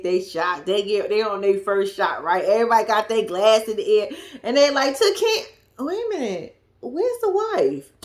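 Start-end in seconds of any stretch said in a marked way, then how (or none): 5.0-7.9 surprised toast. Retold or imitated. Toast cut off by question.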